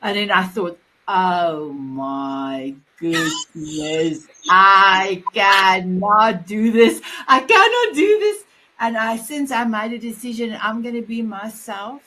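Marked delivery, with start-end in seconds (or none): 1.1-4.2 very slow, low, dragged-out words; exaggerated slow speech. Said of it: "more slower". 4.5-7.0 loud, dragged-out mock slow motion; exaggerated slow speech. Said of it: "more slower". 7.3-8.4 high, loud burst of mock exasperation; mock exasperated outburst. Acted exasperation at speaking slowly.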